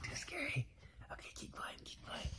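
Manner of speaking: breathless whisper. Real, not acted. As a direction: whisper it urgently. Whispered during scary story.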